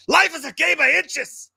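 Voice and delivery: slow, high, loud rousing movie speech; Pacino. Acted. Co-host quoting a famous film speech, yelled Pacino impression. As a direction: holler it like a hammed-up film coach. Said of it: "I can only do Pacino".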